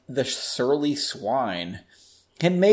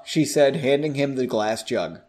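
ordinary delivery, nothing marked

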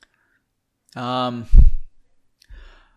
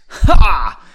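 single loud "Ha!"; acted laugh; 3 semitones higher, 16 dB louder than usual. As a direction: burst out one mock laugh, higher and much louder. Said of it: "Henry laughed".

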